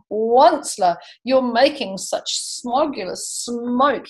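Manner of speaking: lively read-aloud rhyme; storybook reading voice; story narration. A narrator's reading. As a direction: read it to a class with bounce. Reads Dr. Seuss aloud.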